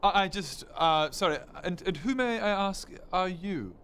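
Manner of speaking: put-on stammering, over-polite posh accent; nervous posh. Son asks stranger who she is, awkwardly posh. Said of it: "What accent is that?".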